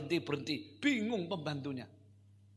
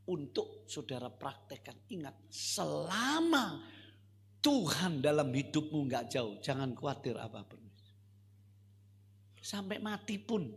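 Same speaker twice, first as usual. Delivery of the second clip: ordinary talk, nothing marked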